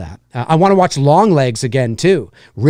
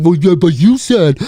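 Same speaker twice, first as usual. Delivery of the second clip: nitpicking commenter voice; 3 semitones higher, 3 dB louder than usual. Retold or imitated. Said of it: "It's like".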